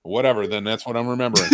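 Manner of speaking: normal talking voice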